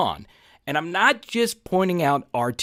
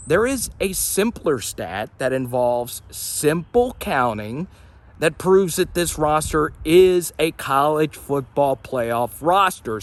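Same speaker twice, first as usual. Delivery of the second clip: usual speaking voice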